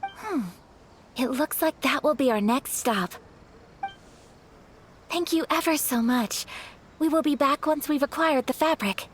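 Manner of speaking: ordinary talk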